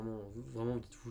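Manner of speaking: normal talking voice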